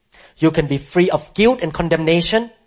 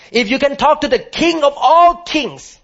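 much higher and louder, ringing out on all; preaching at full force; 11 semitones higher, 4 dB louder than usual. Shown as a demonstration. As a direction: lift it to a soaring cry. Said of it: "Let me repeat one more time".

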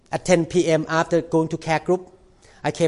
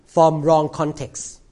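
usual speaking voice

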